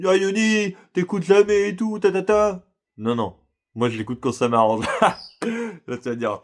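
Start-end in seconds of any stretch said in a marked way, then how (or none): 0.0-2.7 high, slow whiny mockery of a complaining woman; mocking women who say he never listens, high whiny voice; mock nagging woman. 4.9-5.8 cheeky laugh. Real, not acted. Laughs at his own cheek.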